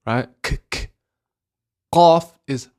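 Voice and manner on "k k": K as a light click off the back of the tongue; short whispered K, no voice; kaf sound. Shown as a demonstration. Teacher contrasting kaf with qaf, light back-of-tongue K. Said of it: "Kaf is at the back of the tongue".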